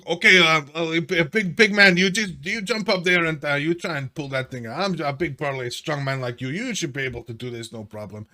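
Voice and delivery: loud, high bossy show-off voice; strutting peacock. Acted. Zudar bossing Mal to pull the spike, loud high peacock voice. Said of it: "strut about like a peacock".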